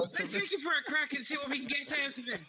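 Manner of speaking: high, quick hammed-up Irish; mock Irish accent. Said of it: "Got a bit Irish".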